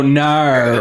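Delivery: Aussie NO, bent to "naur"; Australian accent. Shown as a demonstration. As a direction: demonstrate an Australian "no" ending in an R sound. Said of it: "They go".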